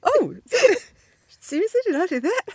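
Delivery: hammed-up shocked surprise; mock astonished. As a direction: gasp it out like you can't believe what you made. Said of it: "I was like".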